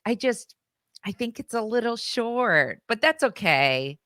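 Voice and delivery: a small girl pretending she's fine; daughter's voice. Daughter's brave reply voiced by mom.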